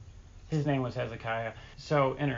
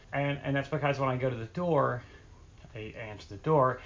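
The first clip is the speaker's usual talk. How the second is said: ordinary talk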